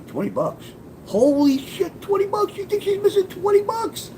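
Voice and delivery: squeaky high incredulous voice; mock disbelief at her small number. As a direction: jump way up in pitch, amazed. Incredulous at twenty bucks.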